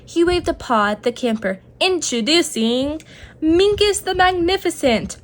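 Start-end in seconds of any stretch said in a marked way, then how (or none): 1.8-5.2 showman introduction. A narrator's reading. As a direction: present the star with a flourish. Rabbit introduces the magician.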